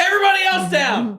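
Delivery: high, loud hammed-up "get down" yell; mock robber shout. Pretend robber with a baby yelling at the room, mock shout. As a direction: bark orders like a bank robber, for laughs.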